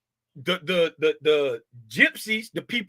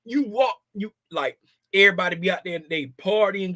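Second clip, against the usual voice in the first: normal talking voice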